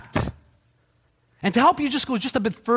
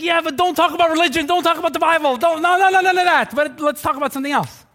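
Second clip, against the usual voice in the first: high, louder fussy "no, no, no"; mock objecting friend; 9 semitones higher, 3 dB louder than usual. A pastor mimicking a friend dodging religion, high and protesting. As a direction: brush off all talk of religion, high and insistent. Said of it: "it's like".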